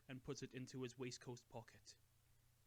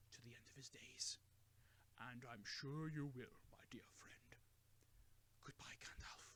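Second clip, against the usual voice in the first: tender farewell; 8 dB quieter than usual. A narrator's reading.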